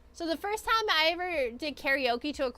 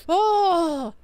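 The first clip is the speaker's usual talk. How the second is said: startled oh; 5 semitones higher, 9 dB louder than usual. Retold or imitated. Sudden reaction to the music.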